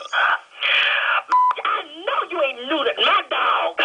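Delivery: slow, tearful wail of disbelief; crying out. Real, not acted. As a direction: break down crying over the dog. Owner hears dog was neutered.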